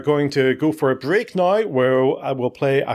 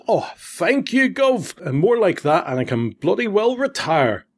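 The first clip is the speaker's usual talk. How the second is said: grateful Cockney; 6 semitones higher than usual. Retold or imitated. Guy thanking him for coins, in a high grateful Cockney voice. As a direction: put on a thankful old London cabbie. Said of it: "and the guy goes".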